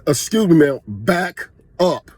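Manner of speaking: firm, drawn-out polite command; retold firm order, slow and deliberate; assertive and direct. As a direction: tell her straight, spacing out the words. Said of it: "a little more certain, uh, assertiveness".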